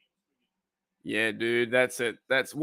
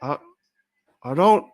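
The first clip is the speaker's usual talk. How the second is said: mock Hank Hill; 2 semitones higher, 5 dB louder than usual. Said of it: "that sounds like someone else".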